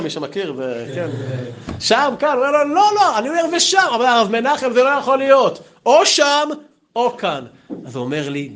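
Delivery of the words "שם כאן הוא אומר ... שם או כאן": sketch voices. Retold or imitated. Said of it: "יש כזה מערכון".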